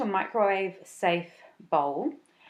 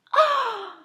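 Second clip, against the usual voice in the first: mock gasp; 6 dB louder than usual.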